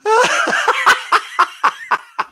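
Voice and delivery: high cackling laugh. Real, not acted. Streamer laughing at the haters he mocked, high cackle. Laughing at his own bit.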